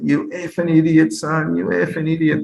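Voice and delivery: a hint of a furious coach; mock rant. Coach scolding his dyed player, mild mock anger.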